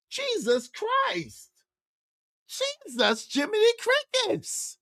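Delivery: high, soft, exasperated sigh of words. Real, not acted. Host reacting to the guest's dodge, high and exasperated. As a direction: throw up your hands in disbelief.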